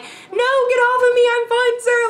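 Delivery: high, loud, strained refusal that stays polite; polite but panicked. Retold or imitated. Woman refusing a drunk stranger's grip, high loud polite plea. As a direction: shout him off while keeping your manners. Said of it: "I'm trying to be so polite".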